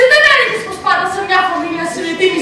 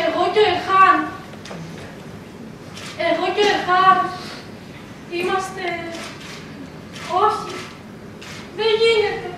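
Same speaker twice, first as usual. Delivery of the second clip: soft, broken off by pauses; stunned denial; 8 dB quieter than usual. Acted. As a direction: refuse the truth in a small voice. Stunned, refusing the dawning truth.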